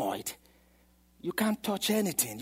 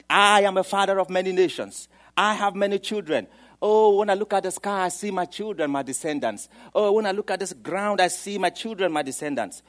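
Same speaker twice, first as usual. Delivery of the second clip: bold joyful declaration; 3 semitones higher, 7 dB louder than usual. Retold or imitated. Abraham declares his children.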